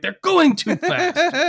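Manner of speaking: high, loud, slow cartoon outcry; Burns. Co-host imitating the boss watching the drag race, high mock cry. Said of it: "he watches, he's like".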